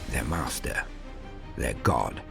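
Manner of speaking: ordinary delivery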